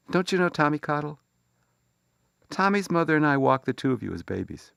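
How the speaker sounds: a hint of a surprised mother; mother's voice.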